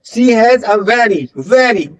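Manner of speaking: very slow, very said with a distinct V; V-focused repetition. Shown as a demonstration. Teacher repeating for learners, slow emphatic V in very. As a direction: dwell on the V of very. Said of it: "वी sound पे focus करना है".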